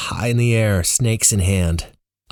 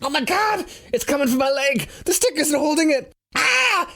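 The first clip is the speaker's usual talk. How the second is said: very high terrified squeals building to screams; cowardly yelling. A narrator's reading. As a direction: lose it completely in terror. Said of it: "we both cowardly yell together".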